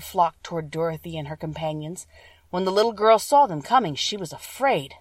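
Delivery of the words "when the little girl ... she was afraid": usual speaking voice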